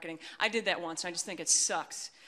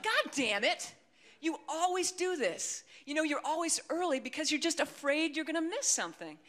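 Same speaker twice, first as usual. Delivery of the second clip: high-pitched exasperated rant; imitated angry boyfriend; 8 semitones higher than usual. Boyfriend scolding her for being always early, mock exasperation. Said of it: "he just lost it".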